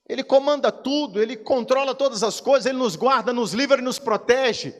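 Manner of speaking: loud, high preacher's proclamation; fiery preaching. Acted. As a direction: preach it out with full force. Preaching at full force. (pregação inflamada)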